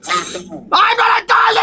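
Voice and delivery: slow, high protesting yell; high indignant shout. Real, not acted. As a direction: shout it back, offended. Rejecting being called darling.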